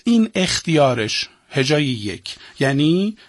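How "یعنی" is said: ordinary talk, nothing marked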